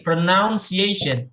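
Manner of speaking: pronunciation drawn out slowly; slow model word. Shown as a demonstration. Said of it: "Sekali lagi".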